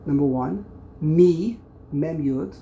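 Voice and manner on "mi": "Mi" punched out loud on its own; isolated syllable. Shown as a demonstration. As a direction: call out "Mi" as the next counted syllable. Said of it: "You break up the word".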